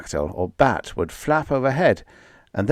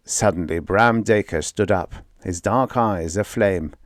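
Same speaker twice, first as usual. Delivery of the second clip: ordinary delivery, nothing marked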